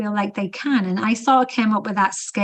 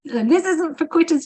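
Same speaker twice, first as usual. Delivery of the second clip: higher, hammed-up drill-sergeant line; mock tough coach; 8 semitones higher than usual. Coach mocking the no-quitters trainer type, hammed-up and higher. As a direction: parody a hardline trainer's slogan.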